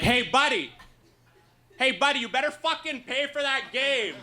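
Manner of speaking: high-pitched mock stripper snapping at a customer; hammed-up high outrage at the guy who dropped the pool table; mock stripper yell. Stripper scolding the customer, high hammed-up fury.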